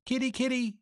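high, coaxing cat call. A narrator's reading. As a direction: call kitty twice, pitched well up. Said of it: "she called".

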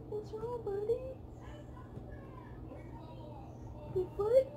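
high, soft coaxing for a frightened dog; soothing pet voice. Real, not acted. Owner coaxing her shaking dog from under the bed, high and soft.

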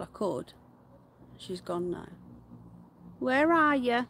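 normal talking voice

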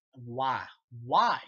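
building to a disbelieving yell; real frustrated shout at a dumb scene; exasperated outburst. Real, not acted.

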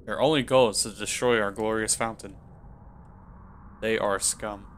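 stern king father. Acted. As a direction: speak down to your son coldly.

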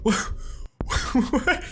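high laughing what-the-hell; cracking up at the ending; laughing disbelief. Real, not acted. Gamer stunned by the ending, high laughing disbelief.

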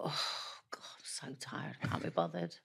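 whispered, weary groan of a complaint; imitating her old self whining in the makeup chair; mock tired moan. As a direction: sigh it out like you can't be bothered. Retold whining to makeup artist.

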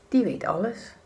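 usual speaking voice

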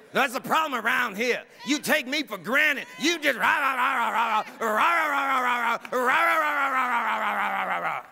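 loud, high, hammed-up nagging rant; mock ranting. Playing the grumpy spouse ranting at the house, a loud mock rant. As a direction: rattle off the complaints as noise.

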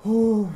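high, loud hoot of nerves; loud whew. Real, not acted. Player nervous about all these bullets, loud whew. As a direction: let out a big high "whew".

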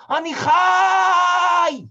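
high, very slow, drawn-out cry; a desperate drawn-out yell of being alive; desperate shout. Acted. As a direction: scream it out so they hear you. Drowned man cries he is alive.

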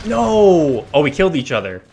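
dismayed shout. Real, not acted. Teammates kill each other.

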